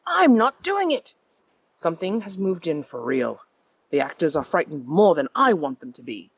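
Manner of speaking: put-on affected posh British accent, with a sneer; sneering theater owner confessing reluctantly in posh British tones; Preston Burke. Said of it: "an affected posh accent named Preston".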